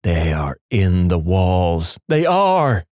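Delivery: slow, drawn-out eerie sleep-talk; creepy. Retold or imitated. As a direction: intone it slowly like an ominous warning. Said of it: "pretty creepy the first times because he was like".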